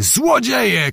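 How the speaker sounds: high-pitched narrated cry, volume held back; Zagłoba. A narrator's reading. Nobleman crying at fleeing foes, contained shout. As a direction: cry out an indignant accusation. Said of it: "krzyknął".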